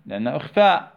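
usual speaking voice

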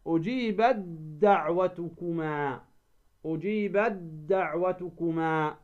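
"أجيبت دعوتكما" as ujibad-da'watukuma; ta merged into dal. Shown as a demonstration. Teacher reciting an example of the rule, أجيبت دعوتكما with ت merged into د. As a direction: turn the ت of أجيبت into a doubled d. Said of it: "تدغم التاء ماذا؟".

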